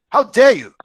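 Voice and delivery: how dare you, high and loud; a sharp loud outburst; outraged. Acted. Father shouting at his son, outraged.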